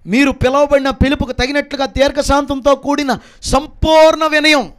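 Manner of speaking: high, slow declaimed verse; scripture proclamation. Acted. Preacher repeats the verse on humility to the congregation, proclaiming. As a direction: declaim it, landing hard on the last words.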